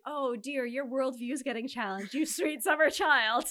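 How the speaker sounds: condescending. Retold or imitated. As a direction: talk down sweetly to a naive child. Condescending retort quoted.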